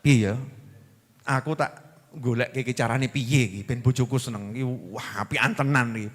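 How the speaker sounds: low, soft thinking aloud; musing husband. Acted. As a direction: play a husband pondering to himself, voice dropped. Acts pleased husband musing to himself.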